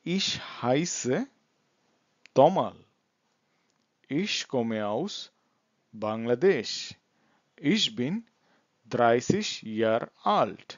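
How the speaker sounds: slow German with gaps between phrases; slow model introduction. Shown as a demonstration. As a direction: introduce yourself slowly for learners. Teacher models practice answers. (ধীরে থেমে থেমে পরিচয়)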